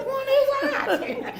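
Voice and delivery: very high and slow, laughing; mock disbelief. Mocking the tiny first methadone dose, high disbelief. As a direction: squeak it out in disbelief.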